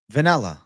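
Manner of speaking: "Vanilla" said as "venella", first vowel like E; "Vanilla" with a short E, ve-NEL-la. Real, not acted. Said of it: "What do you mean I'm pronouncing with an E?".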